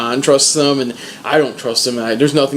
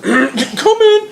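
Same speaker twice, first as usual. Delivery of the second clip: high, put-on composure; composed Sam; 11 semitones higher than usual. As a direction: pull yourself together and call out high. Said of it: "He's like".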